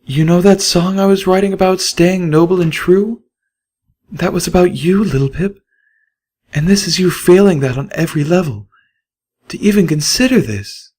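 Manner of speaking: high, cutting mare voice; a wounded mare shaming her friend; high reproachful mare; Velvet Remedy. A narrator's reading. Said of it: "Velvet cut deeper".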